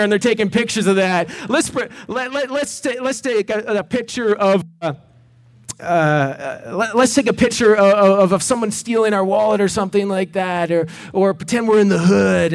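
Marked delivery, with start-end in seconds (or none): 1.5-12.5 put-on stammering excited teens.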